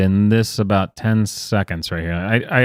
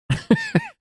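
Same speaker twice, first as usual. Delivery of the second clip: high-pitched giggle; 5 dB quieter than usual. Real, not acted. Exasperated at the clip.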